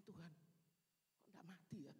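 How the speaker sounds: slow whispered aside to God; guilty whisper. Retold or imitated. Preacher whispering to God, embarrassed the woman lived.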